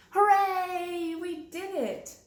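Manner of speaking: high, loud hooray; joyful cheer. Acted. Bird celebrates flying, loud joyful cheer. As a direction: cheer like you just flew.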